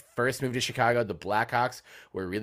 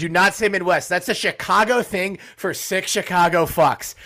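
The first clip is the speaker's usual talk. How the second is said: much higher, louder, emphatic objection; loud protest; 9 semitones higher, 7 dB louder than usual. Real, not acted. Host refusing to let Malort count as Midwest, loud and heated. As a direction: shut the idea down, outraged but joking.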